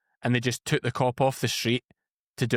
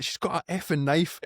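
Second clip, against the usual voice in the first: urgent retold shout; 5 semitones higher than usual. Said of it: "I'm like".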